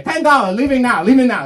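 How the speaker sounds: a hint of a pushy ticket seller; bus hawker calling. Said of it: "You just say".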